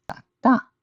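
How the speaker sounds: first 大 low, second 大 high and dropping; low, then falling. Shown as a demonstration. Teacher shows third plus fourth tone, 大大 low then high falling. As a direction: step up from low, then fall sharply on the second 大. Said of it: "Third tone plus fourth tone".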